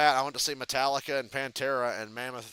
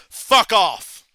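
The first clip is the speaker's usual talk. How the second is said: louder mock fuck off at the drummer; irritated snap; 6 semitones higher, 14 dB louder than usual. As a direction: fire it back, loud and fed up. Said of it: "And James goes".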